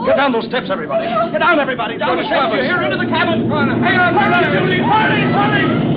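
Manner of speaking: captain yelling at everyone to take cover from the wave; urgent shouted orders. Acted. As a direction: drive everyone below before the wave hits.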